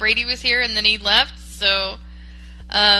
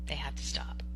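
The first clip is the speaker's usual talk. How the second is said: whispered repeat; 8 semitones lower, 16 dB quieter than usual. Real, not acted. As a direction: whisper "They have to stop" unvoiced and hushed.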